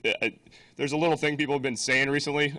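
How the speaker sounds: normal talking voice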